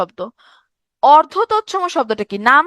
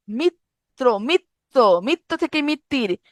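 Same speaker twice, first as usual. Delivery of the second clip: ordinary talk, nothing marked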